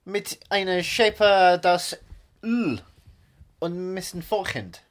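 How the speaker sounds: deliberate, halting fake-German recital; straight-faced Germanised English; mock German accent. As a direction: recite pop lyrics as a cartoon German.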